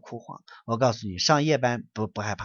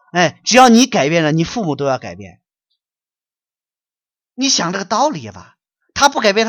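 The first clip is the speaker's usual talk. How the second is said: high, loud preaching rebuke at full force; loud and much higher, berating a wayward son; furious scolding; 6 semitones higher, 8 dB louder than usual. Real, not acted. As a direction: tear into him for failing his parents. Scolding a questioner.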